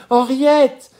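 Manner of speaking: a mother's loud high call. Acted. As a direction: shout the name high. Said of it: "d'un ton plus haut, comme si elle eût appelé".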